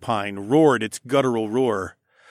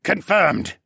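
raised, higher-pitched confirmation; brisk acknowledgement; 6 semitones higher, 4 dB louder than usual. A narrator's reading. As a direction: confirm the order like a soldier. Shouted acknowledgement in battle.

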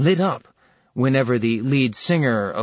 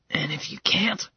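a breathy whisper, barely voiced; whispered worry; 5 semitones higher, 5 dB quieter than usual. Wife whispers her fear about failing the trial, hushed. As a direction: ask it under your breath. Worried question in tense scene.

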